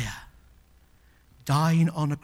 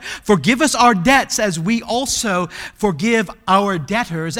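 loud, higher, Forgive us our debts driven home; proclaimed line; 5 semitones higher, 9 dB louder than usual. Acted. Preacher proclaiming the prayer to the congregation, loud. Recites Lord's Prayer loudly.

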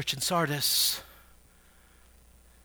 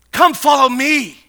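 high, loud summons; ringing shouted command; commanding call; 9 semitones higher, 15 dB louder than usual. Acted. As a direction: call it out at full pulpit force. Preacher repeats Christ's call loudly.

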